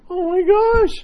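very high, loud squeal; mock-gushing amazement. Mocks being hugely impressed by someone.

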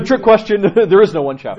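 amused gotcha, high and loud through laughter; loud, chuckling reveal; laughing. Real, not acted. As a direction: laugh as you spring the trick. Laughs at own trick question.

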